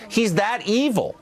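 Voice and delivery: high, slow, drawn-out mock claim; mock incredulity. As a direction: voice their line with sarcastic exaggeration. Mocking the official story.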